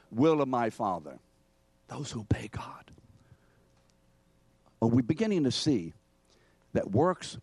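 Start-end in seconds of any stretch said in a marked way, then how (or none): 1.9-2.9 hushed, unvoiced aside; quiet whispered restatement. Real, not acted. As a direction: drop to a soft whisper. Hushed aside after loud answer.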